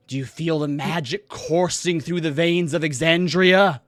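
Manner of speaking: high, loud, swelling stage proclamation; theatrical magician. Acted. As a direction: build grandly to a booming final word. Magician proclaims spell to crowd.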